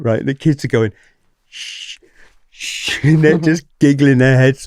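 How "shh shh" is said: put-on children's secretive "shh"; hushing shh. Kids sneaking out under the windows, hushing shh. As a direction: hush each other, imitating sneaking kids.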